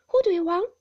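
high, startled and loud; a frightened cry into the phone. A narrator's reading. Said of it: "took the receiver off with trembling hands".

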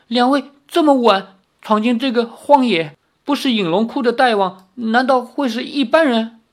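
high-pitched, timid questioning; frightened old man. A narrator's reading. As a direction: question them fearfully in a high old man's voice. (胆怯地高声发问)

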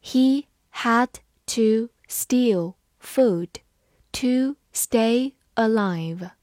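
very slow, one word at a time; drawn-out, careful teacher read-through. Shown as a demonstration. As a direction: leave a gap after every word. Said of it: "好，慢慢来读".

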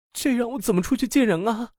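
high-pitched distressed wail; anguished cry. A narrator's reading. Lina despairing over her torn dress, anguished cry. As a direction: cry out in helpless dismay, pitch way up. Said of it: "苦闷地叫道".

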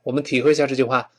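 ordinary delivery

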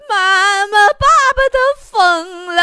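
high, loud put-on wail; mock bawling child. Little son crying to his mother about dad, mock wailing. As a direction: bawl it out like a little kid, hammed-up. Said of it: "儿子哇一声就哭了".

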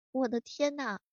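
ordinary talk

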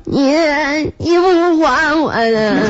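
high, slow mock sobbing; crying while talking. Feverish wife answering husband, slow hammed-up crying. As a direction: blubber the words out, hammed up. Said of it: "我一边哭一边说".

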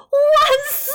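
high-pitched whoop of joy; jubilant shout. A narrator's reading. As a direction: cry out elated, voice leaping way up. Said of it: "达尔叫着跳了起来". (高声欢呼)

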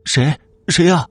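high-pitched, fearful call; scared, high little questions; frightened questioning. A narrator's reading. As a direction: ask who's there in fright, pitched well up. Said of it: "他害怕地问道".

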